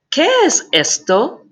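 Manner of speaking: "¿Qué es esto?" with a high rising question tune. Shown as a demonstration. Teacher models asking "¿Qué es esto?" with high rising tune. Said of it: "I would ask the question".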